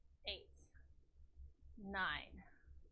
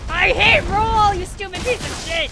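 loud drawn-out angry yell; 6 semitones higher, 11 dB louder than usual. Real, not acted. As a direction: rage at the game. Raging at game controls.

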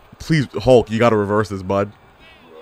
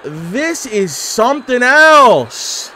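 drawn out slowly, voice rising in awe; stunned disbelief; 9 semitones higher, much slower than usual. Real, not acted. Gamer stunned by a ridiculous wrestling game, slow awed drawl. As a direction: let each word hang in disbelief.

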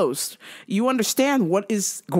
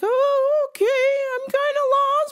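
lost lady impression. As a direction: pitch it way up for a confused little lady. Voicing the lost lady customer.

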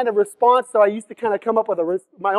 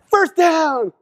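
high whooping mock celebration; celebrating player; 11 semitones higher, 7 dB louder, much slower than usual. Mocking football players who over-celebrate, whooping loud. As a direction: whoop it up like a showboating receiver. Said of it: "they're like".

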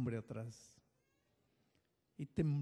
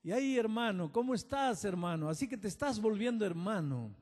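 mocking tone; 6 semitones higher, 3 dB louder than usual.

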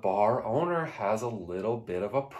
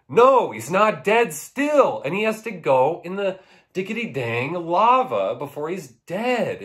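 loud, high mock-incredulous rant; comic exasperation; 7 semitones higher, 6 dB louder than usual. Ranting that the silver guy will not die, loud and exasperated. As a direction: build question and answer into a worked-up shout. That's how I would be feeling.

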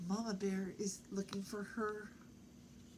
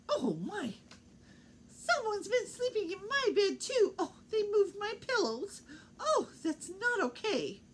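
much higher, louder fretting mama; Mama Bear's voice; 13 semitones higher, 5 dB louder than usual. Acted. Mama Bear finding her bed slept in, high upset voice. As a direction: wail it like an upset mother bear.

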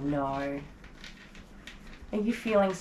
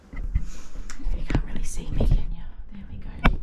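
whisper; 3 dB louder than usual. Real, not acted. Whispering while holding dog.

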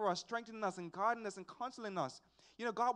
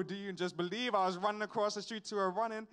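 ordinary talk

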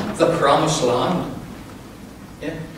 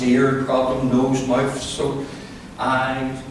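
low, monotone litany of complaints; mock self-pitying prayer; 6 semitones lower, 4 dB quieter than usual. Preacher imitating a drowning believer's prayer, a droning list of troubles. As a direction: drone through the ailments in a subdued voice.

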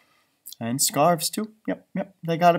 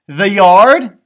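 louder, eager; eager suggestion; 4 semitones higher, 12 dB louder than usual. Acted. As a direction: offer it up with enthusiasm. Character suggests a sledding place.

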